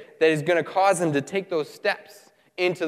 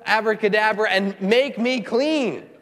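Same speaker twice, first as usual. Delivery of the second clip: higher-pitched mock-indignant protest; angry complaint; 5 semitones higher than usual. Preacher acts out Naaman's disappointed demand for fire from heaven, mock angry. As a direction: act out an indignant man's tirade, voice raised in pitch. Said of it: "And he's angry".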